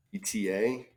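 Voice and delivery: ordinary talk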